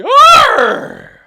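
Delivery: surprised pirate "arr", very loud, high and long; surprised pirate yell. Shown as a demonstration. Pirate showing a surprised arr to children, high startled yell. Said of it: "And if we're surprised".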